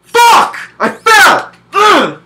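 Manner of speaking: very high, loud furious screaming; furious gamer shout. Acted. Gamer demonstrates falling to his death, furious screaming. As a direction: scream like you just lost hours.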